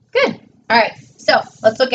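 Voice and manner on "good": high praise. Real, not acted. Praising child's work.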